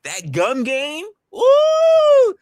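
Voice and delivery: high, slow, hyped shout; excited shout. Real, not acted. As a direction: belt it out with glee. Excited exclamation.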